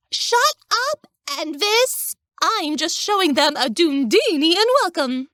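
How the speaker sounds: put-on high, bright cheery girl voice, stressing Dundinian; chipper and cheery. A narrator's reading. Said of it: "emphasizing Dundinian as chipper and cheery".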